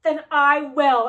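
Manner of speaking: loud, slow, determined; defiant vow. Retold or imitated. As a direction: punch it out as a challenge. Heroine vows to attack.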